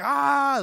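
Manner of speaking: high, loud mock scream; shocked cry. Buggy's gaping face at the ship, high shocked cry. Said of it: "like, he's so shocked".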